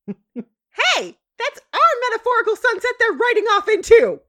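high, loud outraged shriek; Sweetie Belle yell. Acted. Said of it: "it's another Sweetie Belle Yell moment".

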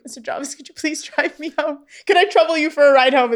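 high, tremulous plea close to tears; a hint of a teary British lady asking for a ride; mock near tears. To Mister Jarvis, a teary high plea. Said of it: "near tears and she's like".

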